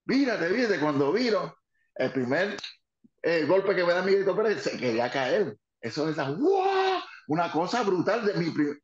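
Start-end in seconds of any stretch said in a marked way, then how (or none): 0.0-0.8 mock warning call. Mother shouting warning ringside. 6.3-7.0 amazed wow. Real, not acted. Wrestler reliving the crowd's roar, amazed.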